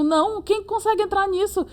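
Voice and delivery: high, exasperated question; exasperated retort. Retold or imitated. Answering her friend about the crashed site, exasperated. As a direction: scoff at the broken site. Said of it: "Eu digo".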